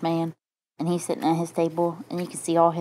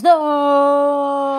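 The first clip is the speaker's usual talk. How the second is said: high, loud anguished no; shouted no; 9 semitones higher, 9 dB louder than usual. Acted. Mustache Man protests as the lady takes the pumpkin, shouting. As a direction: cry out in despair. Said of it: "And the Mustache Man says".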